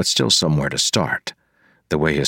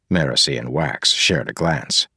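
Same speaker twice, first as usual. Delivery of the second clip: ordinary delivery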